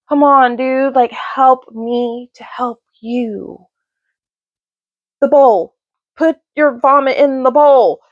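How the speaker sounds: put-on drawn-out, exasperated plea to a kid; exasperated pleading. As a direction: re-enact begging a child to cooperate. Pleading with sick child, retold.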